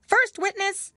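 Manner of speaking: high, loud herald call. A narrator's reading. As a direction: call it across the court. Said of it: "called out".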